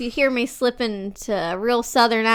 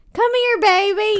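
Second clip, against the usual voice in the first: high, louder Southern coo; imitating own high Southern mom voice; Southern "cigarette mom"; 10 semitones higher, 5 dB louder than usual. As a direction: call a child over in a Southern twang. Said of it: "You sound like a cigarette mom".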